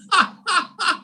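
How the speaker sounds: a burst of high laughter at his own joke. Real, not acted. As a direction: laugh high and free at the punchline. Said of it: "That's the story".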